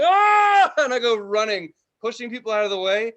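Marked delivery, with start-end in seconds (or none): 0.0-0.7 high, loud cry of escape; short loud yelp of relief; relieved scream. Retold or imitated. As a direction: yell as you burst out of the cage. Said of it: "I was like".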